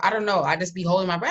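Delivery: ordinary talk, nothing marked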